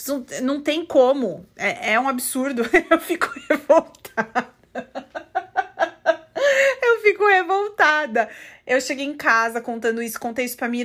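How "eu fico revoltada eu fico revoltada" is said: laughing through real indignation; half laughing, half outraged; laughing indignantly. Real, not acted. Venting to viewers about the girl, laughing in disbelief.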